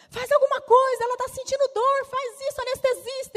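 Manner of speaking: high, loud urgent yelling; frantic shouting. Retold or imitated. Medical team shouting at the anesthetist, high and frantic. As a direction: shout orders across the room in panic. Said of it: "Aí foi uma gritaria entre médico".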